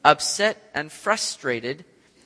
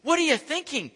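rebuking Peter; 7 semitones higher than usual. Retold or imitated. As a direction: scold Jesus in disbelief. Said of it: "he begins to rebuke him".

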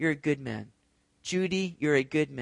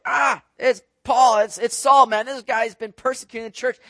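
mock panicky cry, higher and louder, dragged out; scared disciples; 5 semitones higher, 8 dB louder than usual. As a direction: ham up the frightened disciples. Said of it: "They're all like".

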